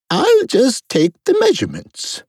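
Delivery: very high royal voice; brisk Queen. A narrator's reading.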